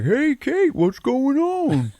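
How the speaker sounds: very high, loud put-on singsong, last words drawn out; oblivious cheery dad. Dad walks out to Kate after the scare, goofy high call. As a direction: call out like a clueless dad, hammed-up.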